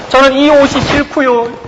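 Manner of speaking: lightly voiced pleading confession; confessing believer. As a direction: beg to wear God's garment. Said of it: "라고 고백한 거죠".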